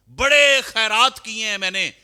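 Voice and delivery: loud, high insistence on his charity; proud protest. Acted. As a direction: cry out your good deeds in self-defence. Narrator voices proud protest.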